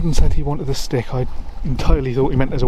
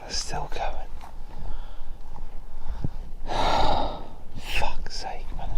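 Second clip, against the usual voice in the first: whispering; 6 dB quieter, slower than usual. Real, not acted. Noises still going nearby.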